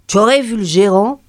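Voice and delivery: ordinary delivery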